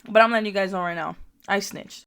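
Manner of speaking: normal talking voice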